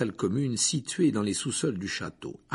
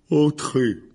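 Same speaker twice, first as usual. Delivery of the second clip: loud gruff giant's growl; 6 semitones higher, 8 dB louder than usual. A narrator's reading. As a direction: growl it like a big gruff man. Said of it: "grogna Hagrid".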